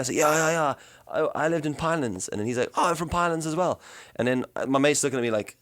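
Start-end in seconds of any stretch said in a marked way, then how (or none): 0.1-2.3 eager, higher South African chat; South African accent. Retold or imitated. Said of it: "to just sounding full blown South African". 2.8-3.8 excited discovery. Retold or imitated. Retold excited South African meeting.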